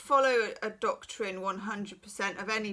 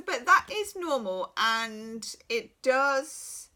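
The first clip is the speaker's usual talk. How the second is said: usual speaking voice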